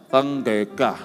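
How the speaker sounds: Penggeka slurred, tongue held down so the d becomes ng-g; mumbled mouth open. Retold or imitated. Patient repeating pendeta to dentist, slurred with open mouth.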